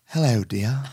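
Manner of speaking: amateur English accent with a laugh; playful go at an English accent; attempted English accent. Shown as a demonstration. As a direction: try on a British accent. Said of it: "wish I had a good English accent".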